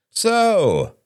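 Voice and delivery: drawn-out, high-pitched opener; big expansive host. A narrator's reading. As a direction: throw it wide open to the whole table. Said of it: "said Robert expansively".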